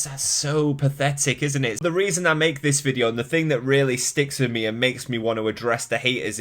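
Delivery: usual speaking voice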